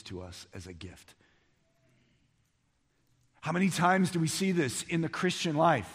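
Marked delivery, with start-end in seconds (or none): 3.4-5.9 high, loud, driving preacher voice; full-force preaching. Acted. Pastor rousing his congregation, loud and high. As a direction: preach it out to the whole room.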